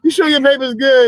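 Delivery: high, skeptical teasing question; mock doubt. Friend parroting the girlfriend's question, mock suspicious. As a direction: echo it back, mockingly doubtful.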